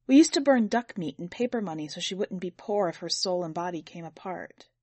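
usual speaking voice

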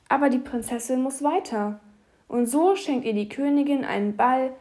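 plain reading voice

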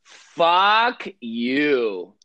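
loud drawn-out fuck you; exaggerated. Shown as a demonstration. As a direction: lean hard into the curse. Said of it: "a exaggerated".

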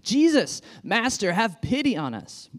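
high, loud plea from afar; calling out. Acted. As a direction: cry out across the distance for mercy. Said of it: "called out in a loud voice".

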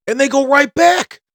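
ordinary delivery

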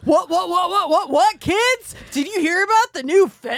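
hammed-up churchy youth leader hype; cool teen pastor. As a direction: pitch it like a cool pastor selling to kids.

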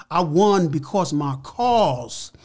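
slow, each word weighted, preacher-style; slow emphatic point. Real, not acted. Telling the interviewer why he really won, slow and weighty. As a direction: land it slowly like a sermon.

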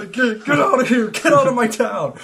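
mock furious shouting; angry shout. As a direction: rant at the homeless like a madman. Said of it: "felt like jumping on the sleeping bag".

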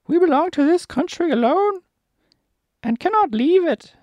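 very high, loud monkey squawk; loud squeaky cartoon monkey; Monkey King, demanding. Monkey King asks Dorothy her wish, high loud squeaky voice.

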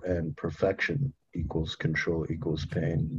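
sit with it, quietly; quiet reflective repeat. Real, not acted. Host slowly repeats the equations.

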